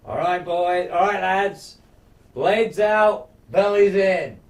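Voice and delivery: loud battle cry to his men; rallying shout. Retold or imitated. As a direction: bellow it like a war chief before the charge.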